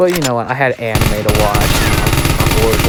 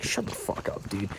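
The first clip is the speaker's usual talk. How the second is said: a whispered comeback to the thief; hissed retort; 2 semitones lower, 16 dB quieter than usual. Retold or imitated. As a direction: spit it out under the breath. Retort to the kid.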